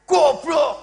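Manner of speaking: high, very loud insult; demonstrated angry shout. Shown as a demonstration. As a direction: yell it full force as a demo of anger.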